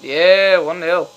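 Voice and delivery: real triumphant yell; goal celebration. Real, not acted. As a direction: cheer the goal at full voice.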